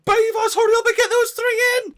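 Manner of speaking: high-pitched, frantic order to hurry; urgent call. Real, not acted. Urgently calling to game beavers.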